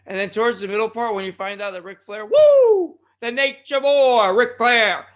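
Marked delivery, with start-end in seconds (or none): 2.3-3.0 high, loud "woo" whoop; piercing wrestler's catchphrase whoop; Ric Flair. Retold or imitated. Podcast host hyping the wrestling news, high whooping woo. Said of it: "That's my Ric Flair shimmy". 3.2-5.1 slow, loud, raised ring-announcer call; wrestling announcer hype. Acted. Host introducing a wrestling legend, loud announcer hype. Hyping wrestler's name to listeners.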